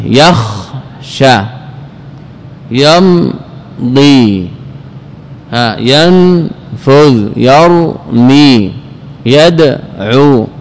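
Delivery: very slow, each verb split in two; syllable by syllable. Shown as a demonstration. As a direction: hold the first syllable, pause, then finish the word. Teacher splits verbs to show silent second letter. (ببطء شديد، مقطعًا مقطعًا)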